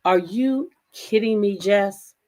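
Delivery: ordinary delivery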